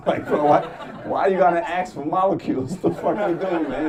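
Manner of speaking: high, loud laughing protest; laughing disbelief. Real, not acted. Comic asks firemen why they brought an axe, laughing. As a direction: laugh through the outrage.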